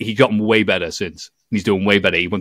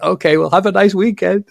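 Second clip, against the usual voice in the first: awkward cheery goodbye; 10 semitones higher, 4 dB louder than usual. Retold or imitated. Retold awkward goodbye to wrestler.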